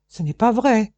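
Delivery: accused man denying the charge in protest; pale protest. Acted. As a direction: protest it loudly and high. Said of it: "voulut protester Vacariadès blême".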